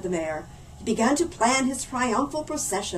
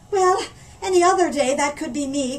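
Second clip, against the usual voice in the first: louder, higher frazzled cry; harried mother crying out; cried out; 5 semitones higher, 6 dB louder than usual. Acted. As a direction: exclaim in exasperation. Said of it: "cried the mother".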